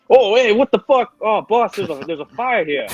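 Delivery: loud, high-pitched alarm; startled henchman. Acted. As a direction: yelp to the boss in a high put-on voice. Henchman startled by sudden fire.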